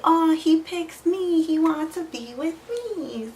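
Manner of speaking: high, loud, slow dreamy mock swoon; mock her younger self, giddy and gullible; naive lovestruck girl. Mocking her naive self for taking him back, giddy swoon. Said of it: "stupid little [name] was like".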